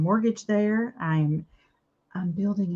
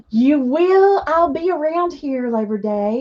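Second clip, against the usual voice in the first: loud, higher, thrilled reply; excited; 5 semitones higher, 7 dB louder than usual. Real, not acted. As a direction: answer her with delighted excitement. Excited reply to friend Beth.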